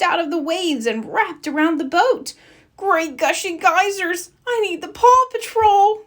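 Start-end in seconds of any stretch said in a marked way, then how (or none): 2.8-6.0 alarmed exclamation. Acted. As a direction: shout it out as the boat is seized. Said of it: "Captain Turbot exclaimed".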